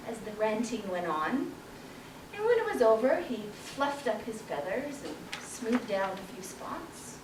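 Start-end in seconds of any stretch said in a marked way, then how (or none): none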